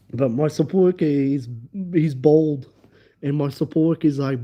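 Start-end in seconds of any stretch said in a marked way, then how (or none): none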